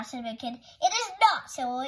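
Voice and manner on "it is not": high, loud contradiction; indignant protest. A narrator's reading. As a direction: argue back loudly, pitched up. Playground quarrel over the ball.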